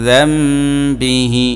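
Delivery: slow chanted যামবিহি; drawn-out recitation. Shown as a demonstration. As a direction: stretch যামবিহি very slowly after a nasal আং. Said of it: "এরপরে আং".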